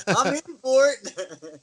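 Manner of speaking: high, slow comic eagerness; gleeful agreement. Retold or imitated. Wrestler signing on to the parody, acted glee.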